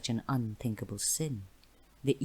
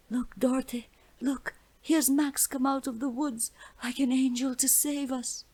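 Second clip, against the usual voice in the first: put-on girl voice; 11 semitones higher, 4 dB louder than usual. A narrator's reading. Girl calls out to rescuer.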